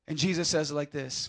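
ordinary talk, nothing marked

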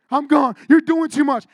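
loud exasperated mother voice; mom's angry retort. Retold or imitated. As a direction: snap it like an upset mom before hanging up. Mom angrily hangs up.

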